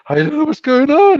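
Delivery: high, loud laughing confession; laughing, clueless. Retold or imitated. Stoned casino dealers back at work, high laughing squeal. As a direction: squeal it through giggles, totally lost.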